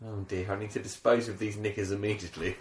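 low, soft, prim resolve; quiet faux-posh resolve; hushed mock-solemn thought. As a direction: murmur it like a grave decision. Mock-formal inner thought, hushed.

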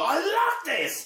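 very high, slow gushing squeal. Retold or imitated. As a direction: squeal it out with delight. Gushing about the show.

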